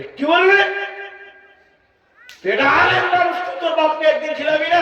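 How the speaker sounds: an elder shouting in disbelief at the scholar; furious shout. Acted. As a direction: explode at him in rage. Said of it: "তখন চাচা খুব রেগে গেছে".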